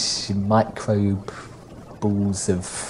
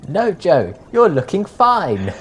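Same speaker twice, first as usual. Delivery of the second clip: high, loud, exaggerated flattery; drawn-out, over-the-top "you're looking fine"; mock reassurance; 8 semitones higher, 5 dB louder, slower than usual. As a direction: play up the reassurance with big pitch swoops. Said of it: "where you step in and say".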